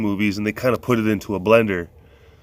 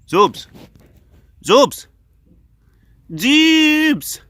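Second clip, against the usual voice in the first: loud high call getting louder; rising shouted call; 9 dB louder, much slower than usual. Real, not acted. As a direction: call the lions in. Calling lions.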